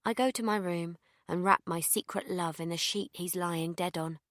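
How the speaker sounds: usual speaking voice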